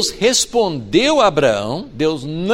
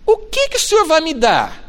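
pleading Abraham; 12 semitones higher, 4 dB louder than usual. Acted. Abraham complaining to God.

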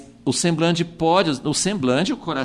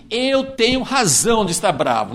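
a touch of indignant Jonah, higher; lightly played stubborn prophet; defiant Jonah; 5 semitones higher than usual. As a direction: stand your ground with a mild huff. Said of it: "E Jonas falou assim".

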